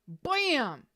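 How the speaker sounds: sudden dramatic exclamation; dramatic bam. Acted. Tarot reader springing the alligator card on viewers, dramatic bam. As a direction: announce the alligator with a dramatic pop. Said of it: "I'm a little dramatic".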